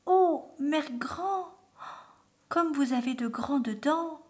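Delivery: high, stretched-out wonder; amazed little girl. A narrator's reading. Girl noticing grandmother's teeth, high drawn-out amazement. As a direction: marvel high, lingering on the first words.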